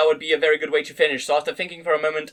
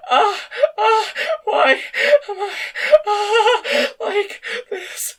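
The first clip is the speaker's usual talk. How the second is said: tearful high cries; 5 dB louder, much slower than usual. A narrator's reading. Woman cries out in love scene.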